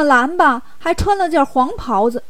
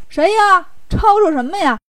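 mild acted yell, higher pitched; 5 semitones higher, 3 dB louder than usual. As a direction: call out, irritated at the noise. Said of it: "大叫着说".

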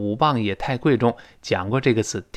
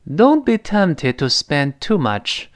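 slow, clear teacher reading of the example; teacher reads an example sentence slowly; slow model reading; 4 semitones higher, much slower than usual. Shown as a demonstration. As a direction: read the sentence slowly for students. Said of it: "他说".

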